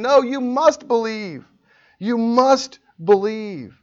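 high, slow, hammered "must believe"; sovereign imperative. Acted. Preacher voicing God's sovereign imperative, slow commanding stress on "must". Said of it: "the sovereign imperative".

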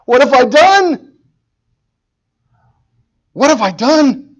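high, very loud, drawn-out wail of regret; anguished cry. Acted. A preacher acting out a sinner's realization, anguished shout. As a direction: cry it out slowly at full force.

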